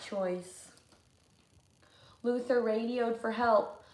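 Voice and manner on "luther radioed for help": usual speaking voice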